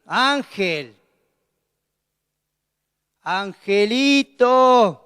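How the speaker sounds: stern drawn-out call. Shown as a demonstration. The Lord calling a straying believer, slow and stern. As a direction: stretch the name out as a warning. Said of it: "con diferentes tonos".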